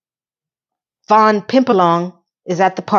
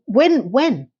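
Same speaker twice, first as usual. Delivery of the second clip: ordinary delivery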